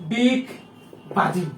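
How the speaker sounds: দিগবাজি drawn out very slowly in two parts, দিগ, বাজি; dental d demo. Shown as a demonstration. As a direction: stretch দিগবাজি, high and loud, with a dental d. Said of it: "এটা যদি আমি দ উচ্চারণ করি".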